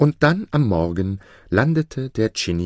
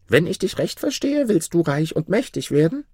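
very high spirit voice; questioning jinni; 10 semitones higher than usual. A narrator's reading. Jinni checks what Maruf wishes for, questioning. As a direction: question him high up in a creature voice.

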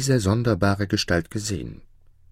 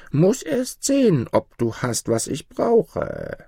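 put-on old hag; 9 semitones higher, slower than usual. Old woman's speech.

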